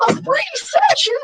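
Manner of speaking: incredulous echo. Retold or imitated. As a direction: squeak out the shock.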